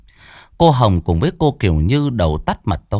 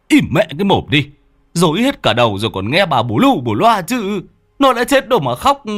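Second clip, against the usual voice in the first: higher, louder, fast angry yell; yelling at wife; 7 semitones higher, 3 dB louder than usual. Acted. Husband yelling at his crying wife to shut up. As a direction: snap at her to shut up. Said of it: "ông Tùy quát".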